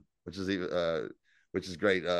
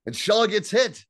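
ordinary delivery

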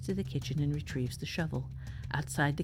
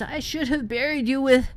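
high, loud enraged scream; 10 semitones higher, 11 dB louder than usual. A narrator's reading. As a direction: scream it out wildly, cut off mid-sentence. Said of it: "and screams".

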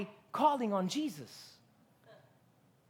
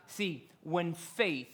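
ordinary talk, nothing marked

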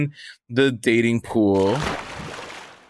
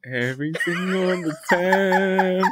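slow mocking repeat; 7 semitones higher than usual. Mocking flub again, slow.

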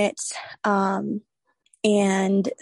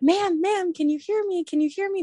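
retold urgent check, pitched high; trying to rouse someone; 10 semitones higher than usual. Tech to fainted patient, trying to wake her, high-pitched. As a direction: imitate someone calling a person back awake, high voice. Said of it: "trying to wake me up".

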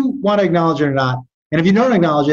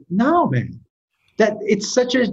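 ordinary talk, nothing marked

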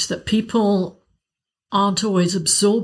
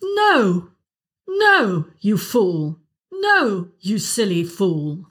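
each No starts very high and falls; No, no, you fool with big high falls; much slower than usual. Shown as a demonstration. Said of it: "Do you want to try it".

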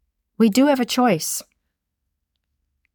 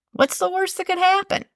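high, upbeat and curious, a real lift of hope; light, optimistic lilt; bright hopeful question; 10 semitones higher than usual. Shown as a demonstration. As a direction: ask it like anything is possible. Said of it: "You can even tell the tone".

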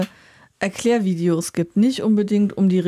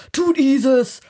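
higher, demanding Viking commander; aggressive Viking voice; 5 semitones higher, slower than usual. Retold or imitated. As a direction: push the player like an impatient Viking. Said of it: "Aggressivität in der Stimme so sagt". (aggressive Wikingerstimme)

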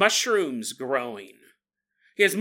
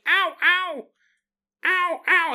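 high, far little ows; tiny mushroom cries; 9 semitones higher, slower than usual. Acted. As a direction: squeak in pain.

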